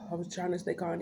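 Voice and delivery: ordinary delivery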